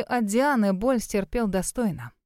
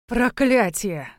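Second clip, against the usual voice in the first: growled curse; much slower than usual. Acted. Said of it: "прорычал Югай".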